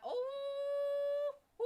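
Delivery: high, loud, long ooh; swooning ooh. Real, not acted. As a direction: squeal a long, delighted "ooh". Swooning over Loki's smooth talk.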